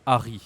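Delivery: "Harry" as "Ah-ree", no h; French pronunciation. Shown as a demonstration. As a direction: say "Harry" starting on the vowel, French r. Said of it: "We say Harry".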